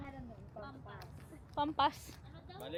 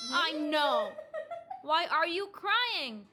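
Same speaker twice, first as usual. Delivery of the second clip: slow, stretched-out sympathetic coo; much slower than usual. Real, not acted. Soothing a crying baby.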